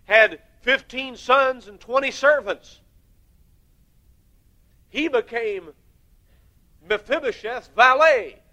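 slow, high and loud pulpit delivery; loud, drawn-out preaching. Acted. Preacher marveling at what the king gave Mephibosheth, slow and loud. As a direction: stretch every phrase out to the back pew.

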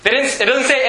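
ordinary talk

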